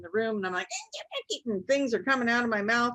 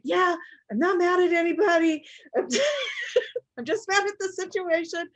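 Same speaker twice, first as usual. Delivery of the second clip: mock yelling son; 10 semitones higher than usual. Son yelling he isn't mad.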